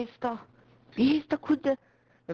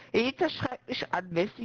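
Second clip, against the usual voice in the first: ordinary talk, nothing marked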